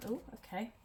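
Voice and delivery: ordinary talk, nothing marked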